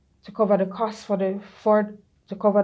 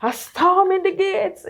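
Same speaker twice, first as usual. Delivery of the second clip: high, loud, laughing mock intercession; fiery prayer warrior; 12 semitones higher, 7 dB louder than usual. Cousin Faye's forceful prayer, mimicked high, loud and laughing. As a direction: imitate a cousin storming heaven in prayer. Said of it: "Faye always a pray".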